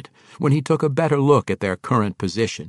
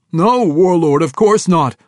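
high, loud, urgent denial; called-out denial; 8 semitones higher, 9 dB louder than usual. A narrator's reading. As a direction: call out hastily to appease the warlord. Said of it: "one called".

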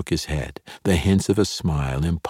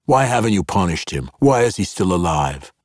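higher, louder, pressing and furious; angry demand; 4 semitones higher, 4 dB louder than usual. A narrator's reading. Zaknafein demands why the attacker lives, angry. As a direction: demand answers, anger rising.

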